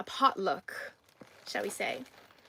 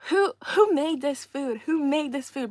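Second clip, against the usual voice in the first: mock angry; 4 semitones higher, 6 dB louder than usual. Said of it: "in, like, an angry way".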